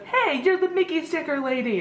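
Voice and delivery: imitating a child's delighted recognition; high-pitched, lightly voiced little kid; retold kid greeting someone he knows; excited kid. Voicing an excited kid recognizing her.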